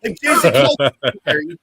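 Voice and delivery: ordinary delivery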